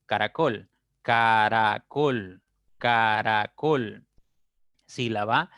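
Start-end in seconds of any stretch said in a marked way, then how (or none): 1.0-2.4 "Caracol" slowly as ca - ra - col; syllable by syllable. Shown as a demonstration. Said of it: "pueden descomponerse en tres sílabas". 2.8-4.1 "Caracol" slowly as ca - ra - col; syllable by syllable. Shown as a demonstration. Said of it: "pueden descomponerse en tres sílabas".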